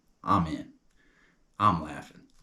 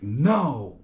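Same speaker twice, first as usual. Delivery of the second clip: loud, raised-pitch stubborn no; mock-defiant refusal; 6 semitones higher, 7 dB louder than usual. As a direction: act out a stubborn guy. Acted guy refusing the mask.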